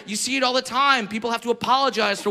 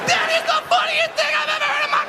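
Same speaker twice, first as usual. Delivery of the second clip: huge laughing shout. Retold or imitated. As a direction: holler with full-body glee. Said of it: "it's like".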